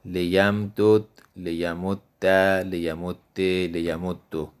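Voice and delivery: لیمدد لیمده لیمده لیمدو drawn out, one vowel ending each; slow drilled forms. Shown as a demonstration. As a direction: spell out each ending of لیمدد slowly, falling at the end. (آهسته و شمرده، هر صورت جدا)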